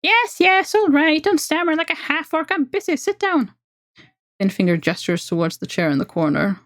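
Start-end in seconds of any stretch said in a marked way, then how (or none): 0.0-3.5 much higher, squeaky impatient elf; squeaky creature snapping orders. Acted. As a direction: squeak it out, busy and curt. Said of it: "broken by a squeaky voice".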